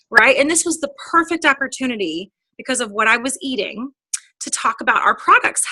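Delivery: ordinary talk, nothing marked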